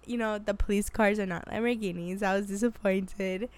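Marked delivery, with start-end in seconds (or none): none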